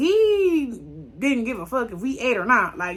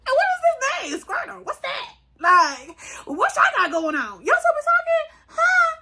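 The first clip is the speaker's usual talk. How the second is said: much higher, loud, laughing mock interrogation; jealous girlfriend; 4 dB louder than usual. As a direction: play the outraged girlfriend grilling her man. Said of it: "if that was my man".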